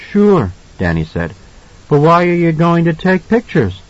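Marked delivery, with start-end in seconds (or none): 0.1-0.5 put-on high child's voice; light, high kid voice; small boy. A narrator's reading. Narrator voices small boy Danny. 1.9-3.8 put-on high, curious child voice; raised-pitch kid's question; small boy. A narrator's reading. Narrator voices small boy Danny.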